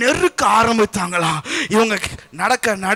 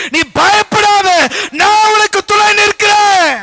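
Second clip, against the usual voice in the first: raised sermon voice climbing to a high shout; preaching, building to full force; 5 semitones higher, 5 dB louder than usual. Acted. Preacher declaring the Creator's promise, building to a shout.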